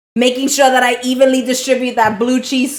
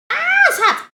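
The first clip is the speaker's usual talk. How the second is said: high yelp at hot food; burned by hot food. Real, not acted. Tastes a hot potato.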